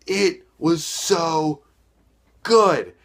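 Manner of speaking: long drawn-out "so", then a high loud "good"; excited fan gushing about the film. Real, not acted. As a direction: stretch out "so" and burst on "good".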